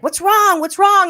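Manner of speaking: high, pressing parent imitation; anxious parent. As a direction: act out a parent demanding an answer, repeatedly. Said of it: "my dad or my mom like".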